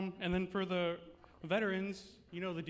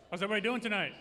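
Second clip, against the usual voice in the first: in front of an audience; 3 semitones higher, 4 dB louder, much faster than usual. Real, not acted. Host hyping up the crowd.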